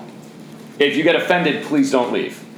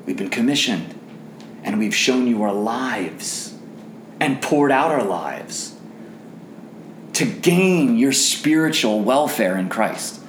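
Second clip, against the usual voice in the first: sincere; 2 semitones lower, slower than usual. Real, not acted. Softer sincere preaching after climax.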